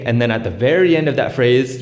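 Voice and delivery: ordinary delivery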